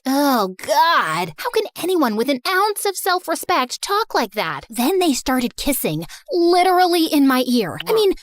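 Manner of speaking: ordinary delivery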